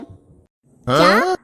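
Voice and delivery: long, loud, stretched-out gasp of disbelief; daughter-in-law stunned at being ordered to cook, shocked; shocked what. Acted. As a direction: let the word hang in stunned disbelief. Told she must cook every meal.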